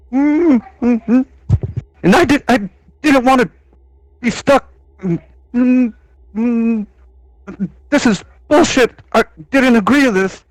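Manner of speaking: high, muffled through the floor; upset character heard from upstairs. Acted. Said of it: "Ketula's muffled voice".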